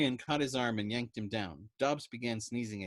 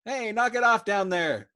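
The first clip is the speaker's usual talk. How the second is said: higher, much louder shout; calling out; 11 semitones higher, 11 dB louder than usual. A narrator's reading. Patient shouting down the ward, much louder. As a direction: yell across the ward. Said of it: "a voice rang out".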